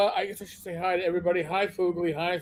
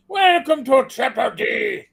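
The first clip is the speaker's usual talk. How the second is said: loud pirate shout, higher; 5 semitones higher, 4 dB louder than usual. Shown as a demonstration. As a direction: bellow it like a pirate captain. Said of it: "I gotta do it with my pirate voice".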